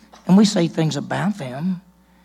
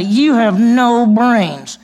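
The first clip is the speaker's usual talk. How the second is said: slow, loud, higher insult; mock insult; 6 semitones higher, 7 dB louder, much slower than usual. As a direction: drag the insult out slowly and loudly. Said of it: "So if you said to somebody".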